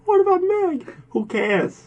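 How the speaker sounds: very high cartoon-dad impression; Peter Griffin. Podcaster imitating a cartoon dad about his daughter, comic impression. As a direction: squeal the question, then toss off the answer. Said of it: "talk just like Peter Griffin".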